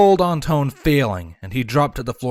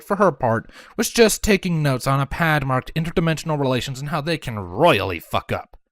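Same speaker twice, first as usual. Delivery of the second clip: ordinary delivery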